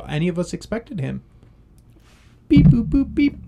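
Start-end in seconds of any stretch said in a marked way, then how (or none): 2.5-3.4 high robotic beep-boop noises; high mock electronic beeps; imitated beeping. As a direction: beep like a little machine, high pitched.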